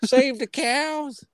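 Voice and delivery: very high, slow silly repeat; squeaky mock echo. Echoing his friend's cow slogan in a silly squeaky voice. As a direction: squeak it back as a joke.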